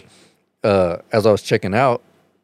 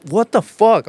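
much higher, louder startled cry; shocked outburst; 12 semitones higher, 5 dB louder, slower than usual. Retold or imitated. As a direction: yelp at the sudden hair pull. Girl's hair pulled.

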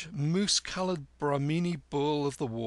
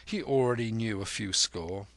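plain reading voice

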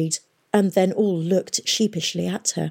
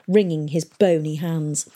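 usual speaking voice

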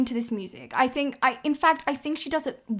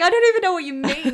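higher, louder and quick, totally confused; baffled reply; 6 semitones higher, 9 dB louder, faster than usual. Pretend. Confused stranger answering the odd proverb, loud baffled blurt. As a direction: blurt it out in bewildered protest. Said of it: "And they're just like".

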